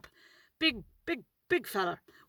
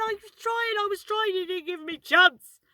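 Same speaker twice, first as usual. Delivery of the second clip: high-pitched protesting mum voice; imitating flustered mum; 10 semitones higher than usual. Mum answering her angry passenger, high flustered protest. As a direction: re-enact mum defending herself, high and put-on. Mum's reply in retold row.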